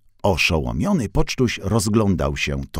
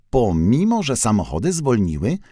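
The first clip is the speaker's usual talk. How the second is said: ordinary delivery, nothing marked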